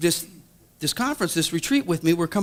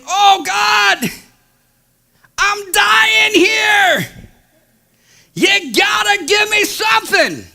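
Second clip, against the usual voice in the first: high, loud, drawn-out plea to God; desperate cry; 13 semitones higher, 6 dB louder, much slower than usual. Retold or imitated. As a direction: cry out to heaven with arms raised. Desperate shout to heaven in prayer.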